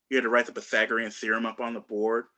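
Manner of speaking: ordinary talk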